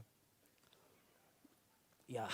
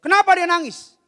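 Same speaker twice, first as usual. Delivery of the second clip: much higher, loud outburst; angry demanding yell; 16 dB louder than usual. Retold or imitated. Father confronting the mother about the crying child, angry yell. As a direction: burst out yelling the question.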